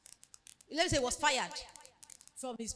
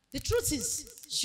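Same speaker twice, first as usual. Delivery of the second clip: slow deliberate; 4 dB quieter, much slower than usual. Acted.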